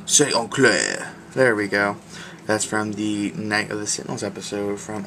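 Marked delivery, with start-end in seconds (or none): 0.0-1.1 slow, drawn-out mock Cajun; second stab at a fake Cajun voice; bad Cajun accent. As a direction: draw it out in a hammed-up Cajun accent. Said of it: "my worst Cajun accent ever".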